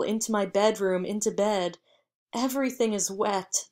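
plain reading voice

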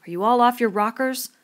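loud, high, exasperated yell; angry shout. A narrator's reading. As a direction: yell at the boys in disbelief. Ralph yelling at the mutinous boys.

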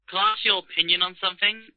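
ordinary delivery

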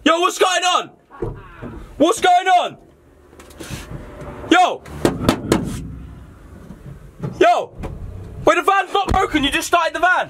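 high-pitched, frantic protest; alarmed shout. Acted. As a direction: call out in rising alarm. Trapped man shouting as van drives.

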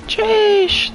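a startled high-pitched yelp of a word; high squeaky jeez. Real, not acted. Driver yelps at a close call in high pitch. Reacts to near miss.

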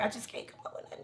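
quick, breathless whisper to herself; a hurried, hushed aside of writer's block; frustrated whisper. Retold or imitated. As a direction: mutter it fast under your breath, stuck for words. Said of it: "I'd be like".

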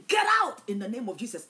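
loud, quick rebuke casting out the devil; preacher's booming banishing command; forceful command. Retold or imitated. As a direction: order it out loudly and fast.

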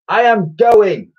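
loud, high, slow declaration; boy's emphatic announcement; announcing. A narrator's reading. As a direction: announce it defiantly, word by word. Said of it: "announced Ned".

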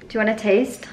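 normal talking voice

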